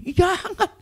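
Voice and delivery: high, loud protest; acted alarm. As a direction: cry out to stop them. Acted horror at suicide threat. (larangan panik, dibuat-buat)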